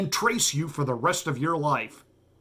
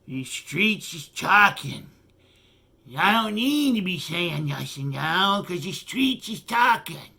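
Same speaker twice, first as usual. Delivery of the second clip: slurred mocking stoner impression, slow at first; lazy, slurry mock of a comedian onstage; Dave Chappelle; slower than usual. Host mocking a comedian at his backyard concert, slurred impression. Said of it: "That was the Dave Chappelle live event".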